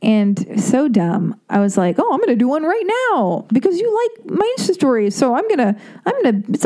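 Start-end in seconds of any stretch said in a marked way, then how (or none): 2.0-6.4 high, giddy put-on voice; hammed-up excitement, much higher; mock giddy self. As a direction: send up your own eager younger self. Mocking her past excited self.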